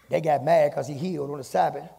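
preaching at full force. Acted. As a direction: drive it home, rising.

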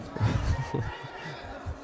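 quick breathy chuckle; short laugh. Real, not acted. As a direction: laugh it off lightly. Commentator laughs after remark. (короткий смешок)

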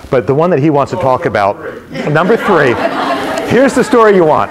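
loud, rising build-up to the reveal; big announcement. Real, not acted. Playfully announcing the awaited story.

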